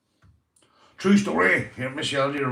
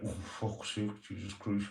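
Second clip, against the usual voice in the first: much softer disgusted mutter; muttered curse; 11 dB quieter than usual. Retold or imitated. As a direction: curse under your breath at the spider. Dad steeling himself to grab spider.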